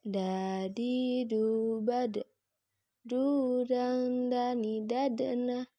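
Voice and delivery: "Du" diayun, dengung "dang" ditahan; ayun dan tahan. Shown as a demonstration. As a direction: lantunkan "dani, dadana" dengan d mati memantul. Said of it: "tahan suaranya". (suara ditahan pada "dang")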